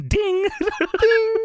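high ding sound effect; mock ding. Host mimicking the lie detector, high ding. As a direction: chime it like a bell.

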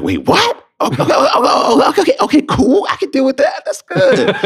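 high, loud, giddy disbelief; lost it. Retold or imitated. Hearing the weekly pay, losing it with excitement. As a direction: squeal with shocked excitement. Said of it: "And then I lost it".

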